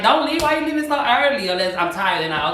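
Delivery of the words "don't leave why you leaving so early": much higher, whiny plea for guests to stay; pleading host. Retold or imitated. Host begging party guests not to leave, whiny and high. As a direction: beg them not to go.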